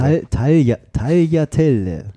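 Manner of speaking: "Tagliatelle" built up syllable by syllable, slowly; "Tagliatelle" drawn out piece by piece; pronunciation demo. Shown as a demonstration. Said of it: "Hier ist auch erklärt, richtig".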